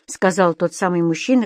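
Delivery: ordinary delivery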